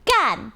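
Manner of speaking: "gan" said clearly with a firm tone; 清楚地说"gan"; Mandarin word demo. Shown as a demonstration. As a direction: pronounce "gan" carefully. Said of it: "G A N".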